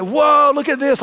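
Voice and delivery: excited whoa. Retold or imitated. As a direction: gush like showing off online. Imitating excited Instagram posters.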